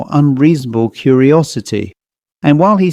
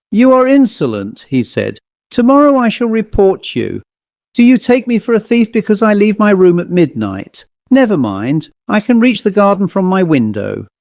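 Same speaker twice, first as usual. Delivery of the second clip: higher, indignant outburst; furious guest; 7 semitones higher than usual. Acted. Guest threatening to report the waiter, angry and high. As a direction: threaten him, voice pitched up in fury.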